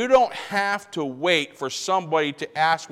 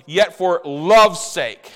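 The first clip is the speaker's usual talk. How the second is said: "love's" stressed, slow and drawn out; much slower than usual. Shown as a demonstration. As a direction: lean on "love's", very slowly. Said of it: "that's the basis of forgiveness".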